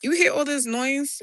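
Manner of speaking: normal talking voice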